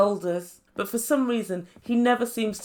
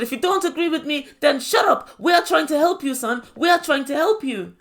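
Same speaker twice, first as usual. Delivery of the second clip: raised, high mock yelling; imitated screaming; 6 semitones higher, 5 dB louder than usual. Imitating his mum shouting down her son, mock yell. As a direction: act out an insistent mother shouting. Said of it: "And she kept screaming".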